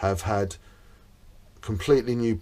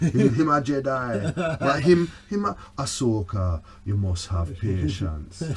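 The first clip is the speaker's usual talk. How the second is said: put-on Jamaican accent; 2 semitones higher than usual. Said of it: "he's got a Jamaican accent".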